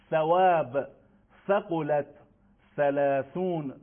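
"ثواب، ثقلت، ثلاثون" each opening on th; spaced model words "ثواب، ثقلت، ثلاثون", tongue between teeth; "ثواب، ثقلت، ثلاثون" with Arabic th for ث; Arabic tha examples. Shown as a demonstration. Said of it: "یا مثال هامون".

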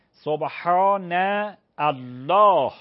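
سبحان drawn out slowly, then الله with its final h clearly pronounced; careful Arabic pronunciation of سبحان الله. Shown as a demonstration. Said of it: "ه باید تلفظ شود".

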